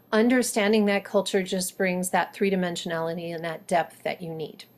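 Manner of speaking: ordinary delivery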